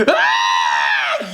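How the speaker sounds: short loud scared scream. Acted. Kid seeing their feet turn to stone, screaming. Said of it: "and scream".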